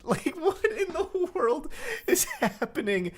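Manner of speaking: high, drawn-out, laughing bafflement; incredulous through real laughter; laughing disbelief. Real, not acted. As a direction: crack up in disbelief, slowly. Baffled by the absurd censored text.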